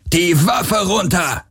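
sharp, slow bellow of a guard; shouted command. Acted. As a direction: stop them cold with a yell. Guard ambushes fleeing pair.

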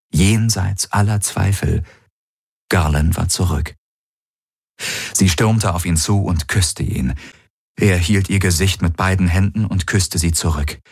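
usual speaking voice

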